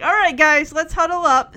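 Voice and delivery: loud, eager mock team call; rallying call. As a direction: call the gang together with gusto. Punky rallying the kids, retold.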